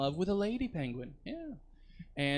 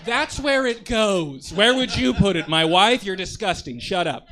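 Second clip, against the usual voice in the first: loud, high defensive outburst; defensive shouting; 6 semitones higher, 10 dB louder than usual. Acted. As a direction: yell it like you're offended. Loud defensive bit to crowd.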